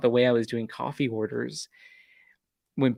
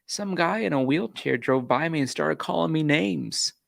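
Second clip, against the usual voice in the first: ordinary delivery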